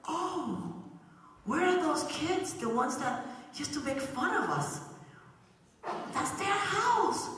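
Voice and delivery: put-on high, astonished child voice; surprised kids. Church kids spot the bullies' house burning, astonished. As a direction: gasp it out as if spotting the fire.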